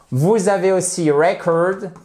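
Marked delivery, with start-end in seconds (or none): 1.1-1.9 "record" stressed on REC, with open e; stress on first syllable. Shown as a demonstration. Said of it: "Vous avez l'accentuation sur la première syllabe". (« record » accentué sur la première syllabe, e ouvert)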